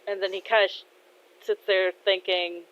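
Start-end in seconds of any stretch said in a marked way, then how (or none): none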